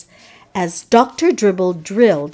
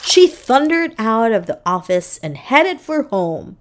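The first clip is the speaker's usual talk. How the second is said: ordinary delivery, nothing marked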